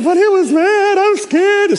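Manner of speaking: high, hammed-up whine of fear; scared baby. Patient pleading with family before the surgeon, scared whine. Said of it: "Oh, he's such a baby".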